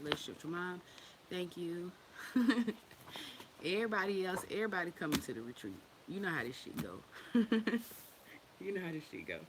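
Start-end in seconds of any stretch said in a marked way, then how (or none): 2.1-3.6 short high laugh; quick amused laugh. Real, not acted. Amused while greeting viewers. 7.0-7.9 short amused laugh; brief burst of laughter. Real, not acted. Amused by own remark.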